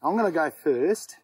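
ordinary delivery

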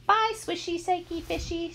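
very high sing-song goodbye; giggly little girl. A narrator's reading. Little sister waving goodbye to a fish, giggly. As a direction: put on a playful small child's voice. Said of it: "she giggled".

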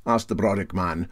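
ordinary delivery

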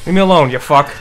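frightened anger. Real, not acted. Monster still pursuing.

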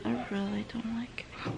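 soft unvoiced whisper. Real, not acted. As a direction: breathe it out quietly. After the scary dream.

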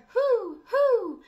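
high, hooting mock owl; hollering owl. The outraged owl hooting, high and hammed-up. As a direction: hoot like a cross owl. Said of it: "who hollered".

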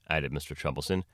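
ordinary delivery, nothing marked

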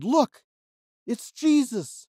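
high excited shout; excited call. Acted. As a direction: point and cry out joyfully. Peter calls out in story.

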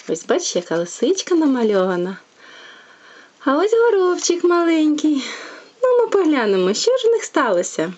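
plain reading voice

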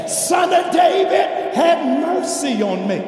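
high, loud, drawn-out plea; loud imitation of a desperate shout for mercy; projected crying out. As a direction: cry out over a crowd, slowly and forcefully. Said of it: "the more they project their voice".

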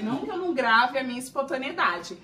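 ordinary delivery

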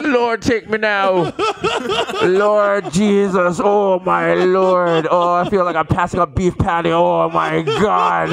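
slow drawn-out mock groaning plea; groaning father in pain. Father on the bathroom floor passing a stone, slow mock groan. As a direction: stretch each word like a man in pain. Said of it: "He's on the floor like".

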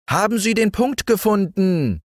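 high beetle voice calling across; beetle calling over. A narrator's reading. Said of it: "rief der Käfer hinüber".

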